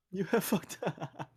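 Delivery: high, slow, loud mock shout; imitating yelling. Echoing the sketch quote to laughing friends, slow mock yelling. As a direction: draw the quote out, breaking into laughter.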